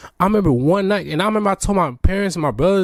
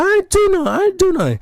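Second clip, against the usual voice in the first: high, yelled denial; angry protest; faster than usual. Retold or imitated. Boy in timeout yelling at accusing classmates, angry. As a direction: protest your innocence, pitched way up. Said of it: "But I said".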